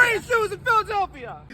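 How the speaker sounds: high, loud excited shriek; wild street holler; ecstatic yell. Retold or imitated. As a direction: yell it to the whole city. Excited shouting from her video.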